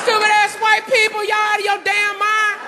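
high-pitched, outraged moviegoer yelling at the screen; heckling moviegoer. Acted. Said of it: "the brother started first. He was like".

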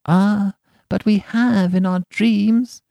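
put-on high, slow childlike reply; little child, drawn out and high; children's voice. A narrator's reading. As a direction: answer like a dreamy child, pitched high. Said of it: "answered the children".

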